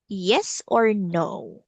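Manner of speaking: slow, clear choice question; slow teacher prompt. Shown as a demonstration. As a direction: spell out the options slowly. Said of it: "teacher slows for child".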